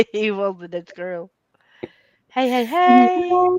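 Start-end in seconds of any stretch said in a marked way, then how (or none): 2.3-3.5 slow, high, loud "hey hey hey"; excited greeting. Real, not acted. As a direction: sing out a big hello. Calling out a greeting.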